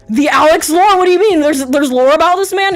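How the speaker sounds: can't believe there's lore; surprised disbelief. Retold or imitated. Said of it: "I'm like".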